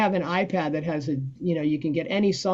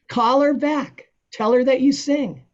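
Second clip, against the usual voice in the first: excited urging; 6 semitones higher, 4 dB louder than usual. Retold or imitated. Friend urging him to take gig.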